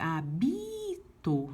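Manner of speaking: a bito stretched very slowly, syllable by syllable; slow syllable count. Shown as a demonstration. Teacher to class, counting to the last stressed syllable. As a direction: draw out a bito as you count. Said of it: "indo até o, a última sílaba tônica".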